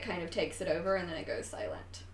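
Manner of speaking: usual speaking voice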